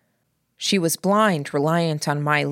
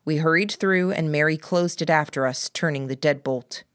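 plain reading voice

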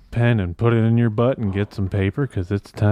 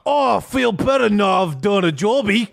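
hammed-up Scottish accent, pitched way up; bad Scottish accent; 9 semitones higher than usual. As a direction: belt out a badly faked Scottish accent. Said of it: "Oh, it should be in Scottish".

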